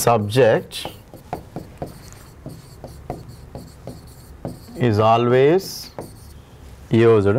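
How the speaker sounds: slow and drawn out, long pauses between words for writing down; slow teacher's dictation so students can copy. Shown as a demonstration. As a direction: dictate it word by word, slowly. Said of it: "అది రాసుకోండి".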